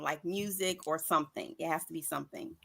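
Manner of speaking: normal talking voice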